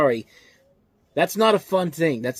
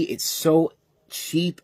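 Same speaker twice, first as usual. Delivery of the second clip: drawn-out scorn; much slower than usual. Real, not acted. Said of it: "slow scornful emphasis".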